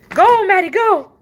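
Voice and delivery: loud high call to the dog; coaxing shout to a stubborn dog. Real, not acted. Calling dog out into cold.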